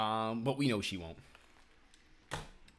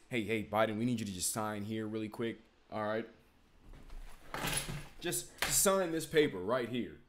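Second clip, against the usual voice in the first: coaxing aide; 4 semitones lower than usual. Pretend. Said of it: "I'ma just".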